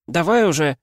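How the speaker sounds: impatient high shout. Acted. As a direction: yell at him to move. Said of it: "крикнула охотница". (нетерпеливый крик)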